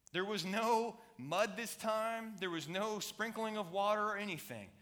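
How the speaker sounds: high, loud, slow preacher climb; impassioned preaching. Acted. Pastor building the story to a loud climax, slow and high. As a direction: pastor preaching the lepers' cry to the congregation, rising and loud.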